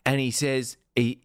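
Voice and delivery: normal talking voice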